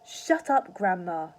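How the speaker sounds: loud, sharp shut up; snapping. A narrator's reading. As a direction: snap it at the old woman. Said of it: "he said".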